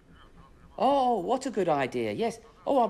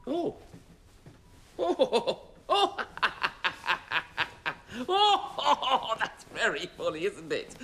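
laughing through the words at his own clown trick; 3 semitones higher, much slower than usual. Real, not acted. Amused driver laughing at his own silly trick. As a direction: giggle on and off while talking.

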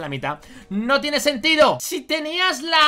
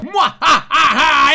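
loud, slow evil cackle; villain laugh; 2 semitones higher, 7 dB louder, much slower than usual. Acted. Villain boss laughs before orders.

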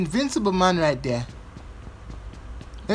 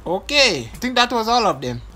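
ordinary talk, nothing marked

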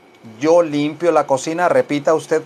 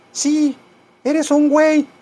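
high falsetto grumpy cat; talking cat; 11 semitones higher than usual. Pretend. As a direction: play an annoyed cat in a squeaky voice. Voices the cat answering him. (gato fastidiado en falsete)

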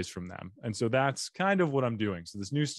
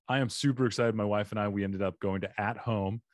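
usual speaking voice

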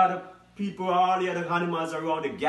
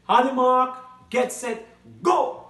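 race starter shout; 8 semitones higher, 7 dB louder, slower than usual. Acted.

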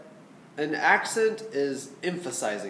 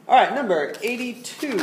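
high, louder bright call to start; rally the student to play; teacher's upbeat high announcement; cheery announcement; 8 semitones higher, 6 dB louder than usual. Real, not acted. Teacher announces next piece.

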